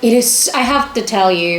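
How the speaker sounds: normal talking voice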